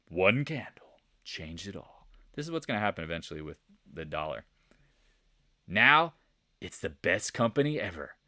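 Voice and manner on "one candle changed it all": slow, hushed whisper for suspense; hushed, drawn-out reveal; dramatic whisper. Shown as a demonstration. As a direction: whisper it slowly, as a solemn echo. Dramatic hushed echo of line before.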